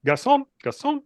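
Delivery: high, loud call of garçon, garçon; loud French call. Acted. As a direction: shout for the waiter across the room. Calling the waiter loudly.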